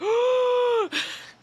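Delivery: loud, very high gasp; shocked gasp. Retold or imitated. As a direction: gasp in alarm at the boss's message. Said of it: "And I was like".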